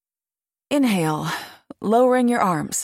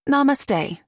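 class in unison; 7 semitones higher than usual. A narrator's reading. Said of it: "the class repeated".

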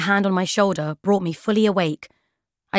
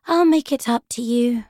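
high, drowsy mumble; sleepy promise; 5 semitones higher than usual. A narrator's reading. Drowsy girl promising a book, sleepily. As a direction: promise it half asleep. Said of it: "I promised sleepily".

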